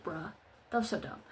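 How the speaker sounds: low, soft, unimpressed deadpan; deadpan bruh. Retold or imitated. Fed up with the TV scene, a soft low unimpressed deadpan. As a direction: drop it flat like you're over it. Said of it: "And then I was just like".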